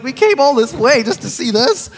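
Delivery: mock-wailing a disappointed son; upset kid. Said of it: "He's crying".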